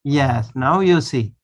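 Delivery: ordinary talk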